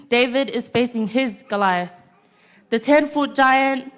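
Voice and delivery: usual speaking voice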